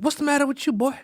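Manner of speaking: a hint of a worried old grandma; grandmother's voice. Grandmother questioning him in story.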